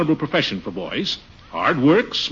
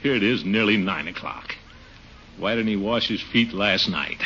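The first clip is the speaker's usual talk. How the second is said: muttering to himself; 7 semitones lower, 7 dB quieter than usual. Acted. Muttering while waiting.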